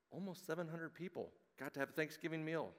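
ordinary talk, nothing marked

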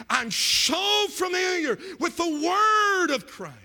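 high shout with long-stretched key words; full-force preacher's cry; shouted preaching. Real, not acted. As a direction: shout it out slowly from the pulpit.

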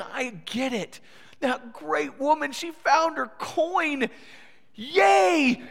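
joyful crowd celebrating the found coin; cheering crowd. Retold or imitated. Crowd cheering the woman's found coin, building to a loud yay. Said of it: "So they're nodding".

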